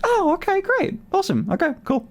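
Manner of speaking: hammed-up upbeat nonchalance; mock breezy surprise. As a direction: play it like cheerful shrugging acceptance. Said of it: "And then I'm like".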